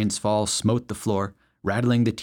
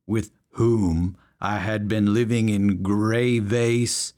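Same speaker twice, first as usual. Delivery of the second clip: slow, laboured read-aloud; squinting slow reading; 3 dB louder, much slower than usual. A narrator's reading. Big man squinting at his paper, slowly reading aloud. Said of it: "brought the paper right up to his face".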